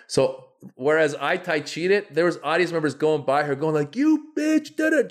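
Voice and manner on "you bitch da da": high shrill mock insult; mock cussing. Audience member yelling at the heckler, mock shrill. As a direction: spit it out like an angry audience member, pitched way up.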